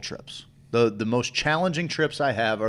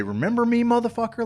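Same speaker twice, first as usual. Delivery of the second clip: high, mocking voice of the trip itself; high sneering mock threat; menacing taunt; 12 semitones higher, faster than usual. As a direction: taunt him like a cruel old acquaintance. The trip personified taunting him.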